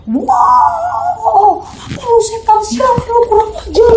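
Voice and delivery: very high furious shout; shrieking, slow outraged curse; screaming at the ghost. Retold or imitated. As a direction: yell the spirit down. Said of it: "gue teriak sekenceng-kencengnya".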